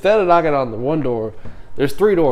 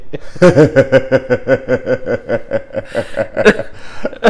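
long, loud burst of laughing; loud laughter; 6 dB louder than usual. Real, not acted. Cracking up over piss poured on someone, loud laughter. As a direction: crack up helplessly at the story. Reaction to the pouring story.

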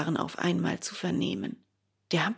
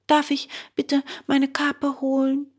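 put-on polite boy's request; 7 semitones higher than usual. Bengele asks the gendarmes.

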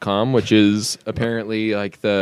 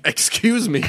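high, affronted comeback; indignant kid; 10 semitones higher, slower than usual. Pretend. Kid named Your Honor answering a teacher, mock indignation. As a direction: pitch it way up in mock offence. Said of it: "the kid would be like".

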